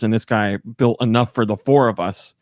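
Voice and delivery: ordinary talk, nothing marked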